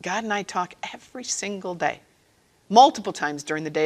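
ordinary talk, nothing marked

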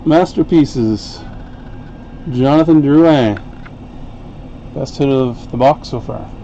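usual speaking voice

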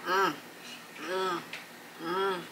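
murmuring while chewing; closed-lip hums with food; mouth full chewing. Real, not acted. Seen 3: Lips closed and moving while holding food pieces, murmuring "mm.

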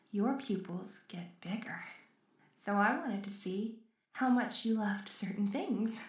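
usual speaking voice

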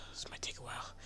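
resigned whisper. Real, not acted. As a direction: sigh then hushed whisper. Waiting to be knocked off.

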